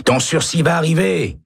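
high, called-out reassurance; shouted encouragement. A narrator's reading. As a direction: call it down the corridor to cheer him. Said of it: "crie Jorgensen".

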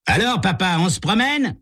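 taunting call. Acted. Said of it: "cria Tommy à l'adresse".

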